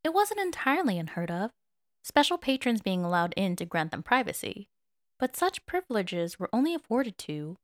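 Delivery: ordinary delivery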